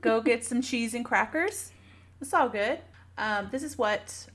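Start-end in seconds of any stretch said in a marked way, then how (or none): none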